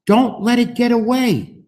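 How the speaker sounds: high, loud cry after the hat; urgent call. Acted. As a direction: call out to stop it running off. Girl calling after blown hat.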